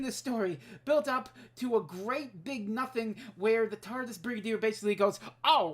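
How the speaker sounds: indignant rant building to a loud, high peak; heated rant. Real, not acted. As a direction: start firm and climb into outraged shouting. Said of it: "I'm getting heated".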